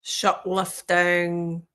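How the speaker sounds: drawn-out mimicked New Zealand accent. Host echoing the woman in the clip, New Zealand accent.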